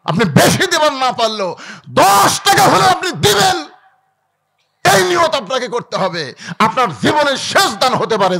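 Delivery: high, loud sermon cry; full-force preaching. Real, not acted. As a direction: drive it home to the whole crowd. (জোর গলায় ওয়াজ)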